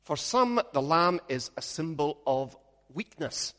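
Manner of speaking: ordinary delivery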